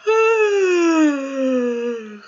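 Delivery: long loud real yawn. Real, not acted. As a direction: yawn taking over. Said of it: "I cannot do anything about the yawning".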